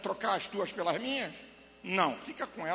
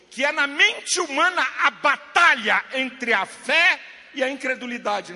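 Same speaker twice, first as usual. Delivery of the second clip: loud, raised, emphatic pulpit voice; full-force preaching; 5 semitones higher, 10 dB louder than usual. Real, not acted. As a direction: drive the point home to the congregation.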